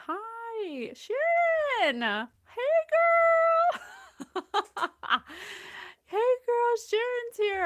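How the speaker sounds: high, loud squealing hello to a friend; excited greeting. Real, not acted. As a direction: greet her like a long-lost friend.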